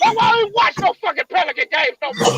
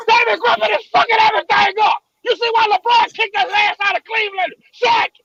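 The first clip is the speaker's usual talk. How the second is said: furious shouting; 3 semitones higher than usual. Real, not acted. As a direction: explode in anger, voice cracking. Furious outburst about GM.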